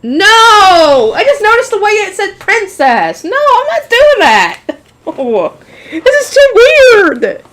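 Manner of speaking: high, loud squeal of embarrassment; embarrassed refusal. Real, not acted. Recoils from reading a cringe line.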